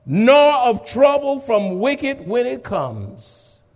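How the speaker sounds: high, ringing exhortation; fiery pulpit cry; preaching at full force. Acted. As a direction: thunder it over the crowd. Sermon exhortation.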